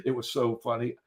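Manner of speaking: ordinary delivery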